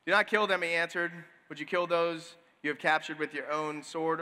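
scripture reading. Acted. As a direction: read the story calmly to the congregation. Reads scripture passage.

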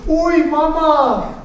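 high, drawn-out comic yelp; mock fright. Moses sees the rod become a snake, mock fright. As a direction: cry out in hammed-up alarm.

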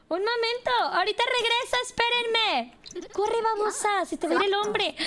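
high-pitched, urgent mock calling. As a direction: call after them in a playful panic. Urging her character after man.

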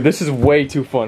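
ordinary talk, nothing marked

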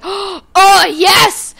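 high, loud, gasping delight; excited exclamation. Retold or imitated. Kid seeing the cereals mom bought, excited shout. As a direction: burst out in hammed-up joy.

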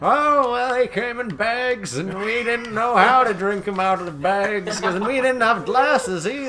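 put-on old-timer voice. Old-timer telling 1950s milk story.

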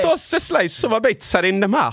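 sing-song cartoon Italian; Italian accent. Shown as a demonstration. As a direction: play a showy Italian pizza man. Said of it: "can you do an Italian accent?".

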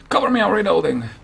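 high-pitched mock battle cry; mimicked soldier call. Player parroting a soldier's line mid-match, high mock call.

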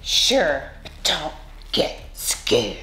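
low, slow breathy monster warning; creepy monster whisper. Acted. Monster warns the frightened kid before showing its tail, low creepy whisper.

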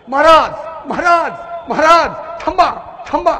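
high, loud calls to halt the king; acted urgent shouting. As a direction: call out urgently, as if running up from afar. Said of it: "मोठ्या मोठ्याने ओरडत आले". (ओरडून हाक मारत थांबवणे)

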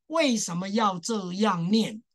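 normal talking voice

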